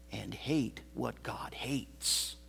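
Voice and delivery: slow, hushed whisper; dramatic pulpit whisper. Acted. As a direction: drop to a breath for weight. Sudden hush after loud line.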